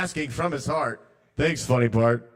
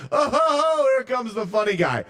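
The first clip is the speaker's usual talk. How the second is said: sarcastic funny guy; 6 semitones higher, 3 dB louder than usual. Acted. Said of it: "That's the part of me".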